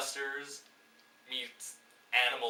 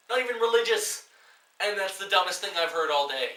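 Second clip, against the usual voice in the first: high, loud disbelieving rant; loud scornful rant; exasperated shout; 6 semitones higher, 9 dB louder than usual. Real, not acted. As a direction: vent in disbelief. Ranting at the film.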